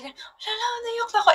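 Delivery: hammed-up teary squeal, half laughing; laughing while crying. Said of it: "nakakatawa kasi".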